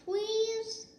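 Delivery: high begging please; pleading. A narrator's reading. Nala begging Simba to play, high pleading. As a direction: coax him to come play. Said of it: "Nala pleaded".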